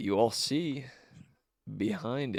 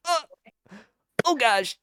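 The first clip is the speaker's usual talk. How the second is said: high, flustered yelp in mock panic; acted little gasp of alarm, pitched way up; panicked; 14 semitones higher, 4 dB louder than usual. Small creature caught hiding panics, high and flustered. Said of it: "he kind of panics".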